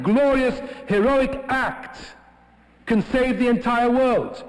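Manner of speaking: ordinary delivery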